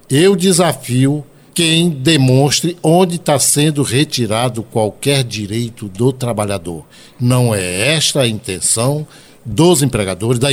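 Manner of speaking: slow, deliberate pauses between words; speaking slowly. Real, not acted. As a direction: spell it out slowly for listeners. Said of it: "eu vou falar pausadamente".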